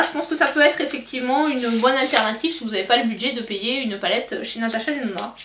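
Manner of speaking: normal talking voice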